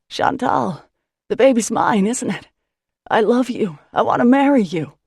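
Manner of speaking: loud, high shouted love confession; shouted declaration. Acted. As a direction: shout it across the harbor. Declaring love across the water.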